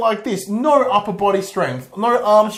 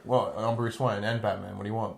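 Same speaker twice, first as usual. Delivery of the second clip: grizzled Batman; 8 semitones lower, 11 dB quieter than usual. Retold or imitated. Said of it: "He's like".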